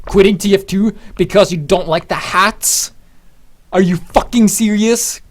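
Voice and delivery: loud, high, slow outraged yelling; angry rant. Retold or imitated. Ranting to his friend on the subway ride home, loud and outraged. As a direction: vent like you can't believe it.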